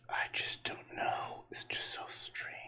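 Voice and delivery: unvoiced whisper, slow and puzzled; hushed whispering, lost for words; bewildered whisper. Real, not acted. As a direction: whisper it to yourself, baffled.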